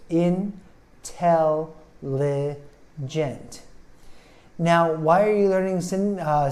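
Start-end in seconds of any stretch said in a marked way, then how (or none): 0.1-3.6 IN-TEL-LI-GENT very slowly, four separate beats; syllable by syllable. Shown as a demonstration. Teacher counting four syllables for learners, IN-TEL-LI-GENT slowly split. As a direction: break IN-TEL-LI-GENT into drawn-out syllables with gaps. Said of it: "It has four syllables".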